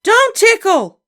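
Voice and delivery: put-on very high, loud child shriek. A narrator's reading. Little girl shrieks as she flees tickling, shriek. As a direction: shriek and run. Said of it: "shrieked Ramona".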